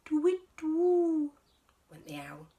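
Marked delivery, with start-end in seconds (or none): 0.1-1.3 high, loud owl call; playful owl sound; owl hoot. Acted.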